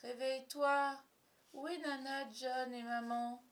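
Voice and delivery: high, slow, drawn-out whine; plaintive little-boy whimper. A narrator's reading. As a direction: whine like a sleepy lost child. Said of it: "pleurnichait Michael".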